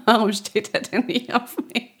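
soft, laughing fake complaint; half-laughing mock self-pity; joking pretend heartbreak, giggling through it; mock lament, laughing. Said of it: "ich mach wirklich nur Spaß".

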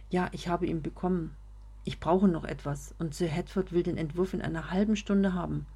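plain reading voice

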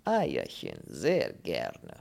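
foreign voice with swooping tune; fond Polish character voice; earnest avowal. Acted. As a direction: profess your fondness with relish. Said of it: "beteuerte der Pole".

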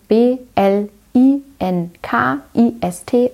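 each letter of B L I N K I S T said separately, clearly; spelling it out. Shown as a demonstration. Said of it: "ich buchstabiere das nochmal".

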